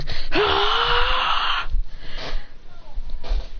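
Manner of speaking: long, high attack scream; high battle scream. Acted. Fighter charging at John, long battle scream.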